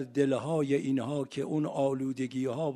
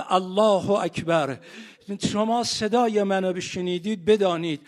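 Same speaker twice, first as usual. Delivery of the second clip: higher, louder wailing rowzeh recital; keen the martyrdom story to the mourners; sermon rising into a loud grieving chant; mourning lament; 6 semitones higher, 8 dB louder than usual. Acted.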